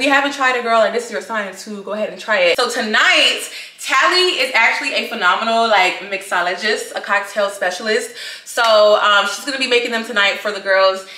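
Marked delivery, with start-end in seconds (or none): none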